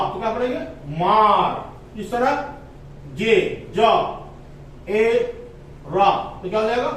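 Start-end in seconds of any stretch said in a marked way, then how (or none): 1.0-2.0 मार drawn out slowly with a long aa; slow demo. Shown as a demonstration.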